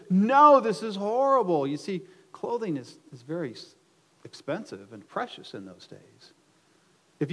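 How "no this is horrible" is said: high, very loud outcry; loud protest. Shown as a demonstration. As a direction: cry out your disgust for all to hear. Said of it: "it's the loudest thing you can do".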